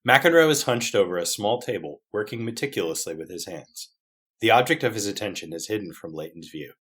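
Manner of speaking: quiet, deliberate scene setting; story narration. Acted. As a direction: read the stage directions evenly. Reads script's scene directions.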